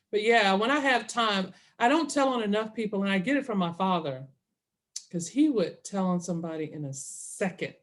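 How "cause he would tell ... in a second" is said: ordinary talk, nothing marked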